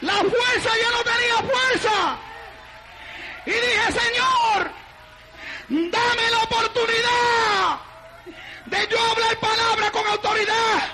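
high, strained shouting testimony; full-force preaching. Real, not acted. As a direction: cry out each phrase in bursts. (predicación a gritos, aguda y ferviente)

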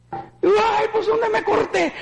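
shouting in pain. Retold or imitated. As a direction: yelp at a sudden cut. Discovers his bleeding feet.

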